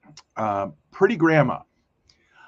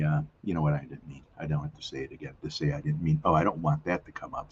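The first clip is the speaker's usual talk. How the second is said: usual speaking voice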